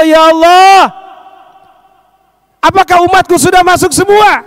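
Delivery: high, loud appeal to God. Acted. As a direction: call upward as the Prophet asking after his people. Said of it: "minta sama Allah".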